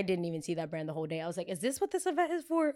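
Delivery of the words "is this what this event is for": high-pitched mock bewilderment; retold incredulous question. Retold disbelief at clueless manager.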